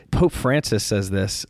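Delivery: usual speaking voice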